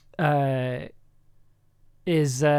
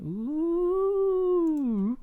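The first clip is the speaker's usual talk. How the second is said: high, drawn-out spooky ghost moan; 14 semitones higher, 3 dB quieter than usual. Pretend. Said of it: "that's my ghost impression".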